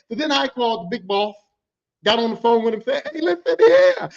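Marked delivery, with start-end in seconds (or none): 3.1-4.1 high, loud, eager attention-grab; gleeful tattling. Retold or imitated. Calling the big boss to report, high and gleeful. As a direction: burst in with juicy news, pitched way up.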